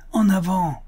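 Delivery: high, loud call to advance; rallying cry. A narrator's reading. Buffalo Bill rousing men to pursue, rallying cry. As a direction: cry out, stirred, to lead the charge. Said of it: "cria Buffalo Bill, très ému".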